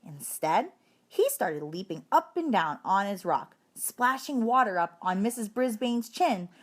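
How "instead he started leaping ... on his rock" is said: ordinary delivery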